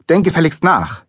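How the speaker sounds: put-on an impatient order to the child; scolding. A narrator's reading. Father to guessing son, scolding. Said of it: "schimpfte Papa".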